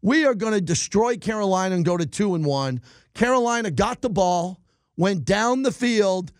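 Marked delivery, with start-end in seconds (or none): none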